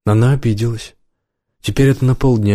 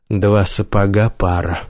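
plain reading voice

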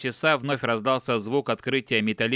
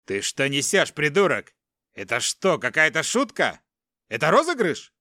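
indignant captive; 3 semitones higher than usual. Acted. Captive confronting a strange man, rising angry disbelief. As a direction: snap back, climbing higher in outrage.

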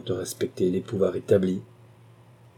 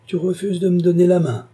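much higher and louder, offended; indignant cry; 10 semitones higher, 6 dB louder than usual. A narrator's reading. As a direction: cry out at the refused handshake. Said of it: "s'écria monsieur de Carquebus".

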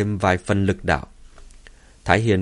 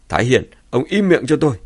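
furious shout, pitched up; louder, higher enraged bellow; angry roar; 4 semitones higher, 5 dB louder, faster than usual. Acted. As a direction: roar at him to shut up. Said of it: "bỗng tức giận gầm lên".

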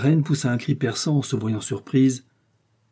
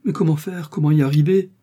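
high, louder anxious questions; distraught questioning; 5 semitones higher, 5 dB louder than usual. Acted. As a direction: ask in mounting desperation, pitch up. Distraught queen seeks a way. (questions angoissées, voix aiguë)

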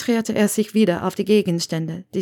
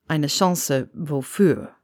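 low, slow man's question; Severus; 3 semitones lower, slower than usual. Acted. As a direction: ask it deep and drawn out.

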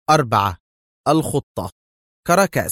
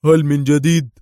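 loud, raised-pitch query; keen interest; 4 semitones higher, 6 dB louder than usual. A narrator's reading. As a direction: ask intently for the latest. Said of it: "سأله دار في اهتمام".

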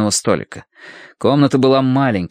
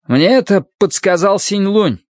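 bravely; 10 semitones higher, 4 dB louder than usual. A narrator's reading. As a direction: boldly, voice raised and louder. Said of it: "храбро произнёс Янь".

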